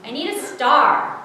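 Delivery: loud, high, emphatic outburst; booming, impatient declaration; thundering. A narrator's reading. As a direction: thunder the demand. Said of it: "The voice thundered".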